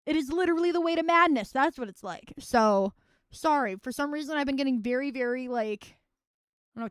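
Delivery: ordinary talk, nothing marked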